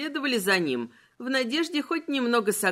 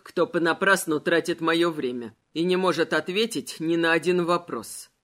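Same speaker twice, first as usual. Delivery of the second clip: skeleton voice; 5 semitones lower than usual. Acted. Malachi voiced by narrator. (низкий властный голос короля)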